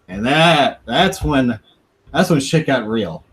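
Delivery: usual speaking voice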